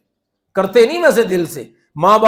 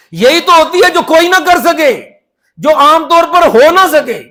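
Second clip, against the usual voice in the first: preaching emphasis; 6 semitones higher, 5 dB louder than usual. Real, not acted. Preacher drives home his point.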